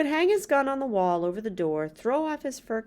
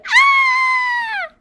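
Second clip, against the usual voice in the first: high, loud, drawn-out scream; child's scream; 14 dB louder than usual. Acted. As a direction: shriek like a scared little girl. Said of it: "Laura screamed".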